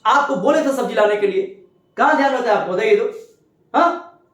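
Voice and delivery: high, loud nagging wife scolding her husband; wife scolding her husband for forgetting the vegetables, loud and high. Acted. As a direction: nag him loudly about the vegetables he forgot, pitch up. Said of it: "जोर जोर से बोलेंगे".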